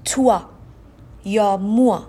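توا and موا with the oi read as وا; French oi sound. Shown as a demonstration. Teacher demonstrating oi to students, crisp demo. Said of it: "برای مثال کلمهٔ".

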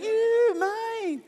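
put-on very high, strained child voice; hammed-up high-pitched little whine; toddler's possessive squeal. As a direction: mimic a grabby toddler squealing. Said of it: "You're like".

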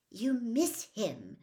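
put-on slow, impatient girl's voice. A narrator's reading. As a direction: press the point impatiently. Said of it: "Hermione said impatiently".

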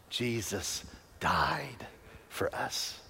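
hushed. Real, not acted. Solemn scripture point.

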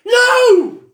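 sudden loud high no; shout. Real, not acted. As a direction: yell it in protest. Shouted protest.

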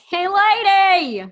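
high, loud, long-drawn call; playful holler. Pretend. Co-host playing with the newsletter name, hollering it comically. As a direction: holler it out, stretching both words.

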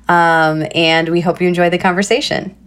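ordinary talk, nothing marked

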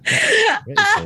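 high shocked shout. Real, not acted. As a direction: cry it out in disbelief. Shocked reaction to east.

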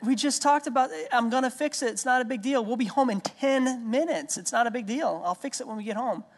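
put-on father pleading with a toddler who won't let it go; exasperated reassurance. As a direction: hold it together while losing patience.

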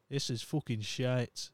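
bad northern English accent on a police officer; a northern English accent, half-hearted. Mocking the police advice in a northern accent. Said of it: "a northern accent".

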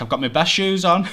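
loud and high, showing off; proud boast. Retold or imitated. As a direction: boast it loudly like a proud mum. Mum showing off her shoes.

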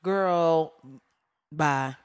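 ordinary talk

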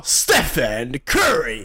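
imitating a low, slow announcer; current arena announcer. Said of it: "han som är nu, han bara".

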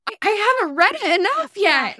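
put-on high, loud whiny child; whiny kid. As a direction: whine like a kid begging. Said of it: "And they're like".

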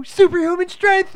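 loud, high cartoon battle cry; cartoon kid shout. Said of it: "He's like".

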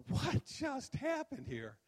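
high, drawn-out disbelief; bewildered outcry. Retold or imitated. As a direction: stretch it out high, utterly baffled. Acted bewildered reaction to rejection.

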